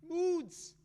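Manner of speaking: mocking the widow's excuse; scornful mockery. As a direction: spit the word out in disbelief.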